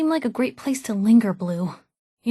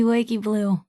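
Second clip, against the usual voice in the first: normal talking voice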